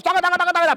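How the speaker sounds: quick mock patter of little feet; imitated running feet. Shown as a demonstration. Coach mimicking players' little feet running, imitated patter. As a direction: rattle off the sound of scurrying feet.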